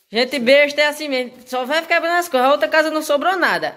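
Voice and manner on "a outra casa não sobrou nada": usual speaking voice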